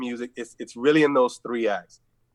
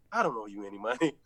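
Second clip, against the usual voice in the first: bad acting; 6 dB quieter, faster than usual. Shown as a demonstration. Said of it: "forgive my acting".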